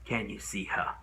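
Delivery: low, soft, grave question; hushed appeal. Acted. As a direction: ask it quietly, weighed down. Asks jury to picture victim.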